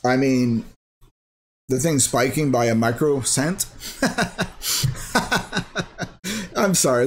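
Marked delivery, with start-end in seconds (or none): 3.7-6.5 a high, breathy burst of real laughter; helpless giggle at the tiny gain. Real, not acted. Laughing at a tiny price spike. 6.5-7.0 laughing. Real, not acted. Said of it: "it's funny".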